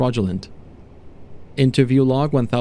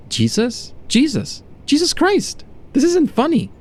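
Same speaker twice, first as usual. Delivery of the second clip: much higher, climbing from question to exclamation; panicked test subject; 13 semitones higher, slower than usual. Acted. Subject to doctor on recognising Jesus, panicked. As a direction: voice shooting up in acted shock.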